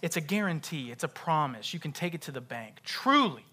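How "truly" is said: "Truly" launched high and loud, falling; TRULY high, loud and standing alone; stress on TRULY. Shown as a demonstration. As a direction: punch "Truly" as a single emphatic word. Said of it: "just emphasize a different word".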